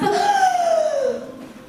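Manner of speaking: sharp high gasp for air; a dramatic, staged gasp; loud gasp. Acted. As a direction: gasp loudly as if drowning. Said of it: "I mean, we gasp".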